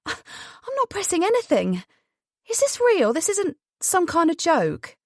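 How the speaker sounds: high-pitched, stretched-out disbelief; incredulous woman. Acted. Cora balks at Jonathan's offer to press the button, incredulous. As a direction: voice leaps up, refusing to believe it.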